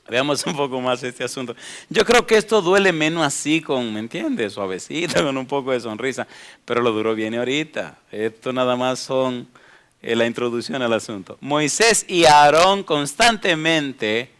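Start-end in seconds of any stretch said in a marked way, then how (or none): none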